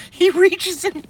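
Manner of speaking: squeaky high through laughter; can't get past the words for laughing; laughing fit. Real, not acted. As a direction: giggle fit.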